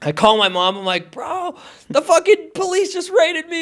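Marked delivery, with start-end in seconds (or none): 1.1-3.6 high-pitched, slow retold wail of dismay; mock distressed. Man retelling his call to mom after raid, mock panicked. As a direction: draw it out, imitating your panicked self.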